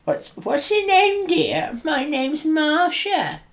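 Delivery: very high, slow mock-sweet voice; put-on character voice. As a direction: play Marsha in a silly squeaky falsetto. Improvised dialogue with invented Marsha.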